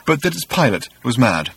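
plain reading voice

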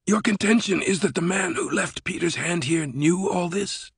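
high, soft voice of a doubting director; sceptical Sato character voice. Acted. Sato questioning the professor's contention, sceptical. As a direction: challenge him in a high, altered voice.

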